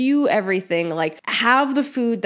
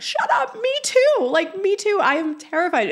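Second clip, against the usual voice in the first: much higher, thrilled shout of recognition; excited disbelief; 12 semitones higher than usual. Retold or imitated. Telling a stranger at the event she's scared too, high excited squeal. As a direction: squeal it like you just found a kindred spirit. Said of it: "And I'm like".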